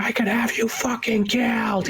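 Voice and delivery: high put-on yell of an angry producer; road-rage producer threat, voiced high and far off; mock road rage threat. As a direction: bark it like a raging big shot.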